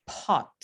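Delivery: "pot" with a clear breath after the p; aspirated p. Shown as a demonstration. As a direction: let air burst out after the p in "pot". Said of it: "pero decimos".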